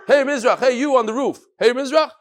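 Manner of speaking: calling up. Acted. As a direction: hail someone up on a roof. Acted call up to the roof.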